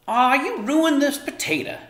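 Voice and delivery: high, loud grumbling mother. A narrator's reading. Mother grumbling at daughter over ruined potato, loud high grumble. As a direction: grumble it out crossly. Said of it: "she grumbled".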